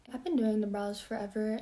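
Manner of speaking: usual speaking voice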